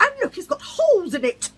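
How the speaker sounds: high, loud excited show-off; high excited woman. Retold or imitated. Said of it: "She went".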